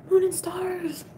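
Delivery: "Moon" sung out high and bright, falling after; delighted hello to a viewer; excited greeting. Real, not acted. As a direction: squeal the name up high.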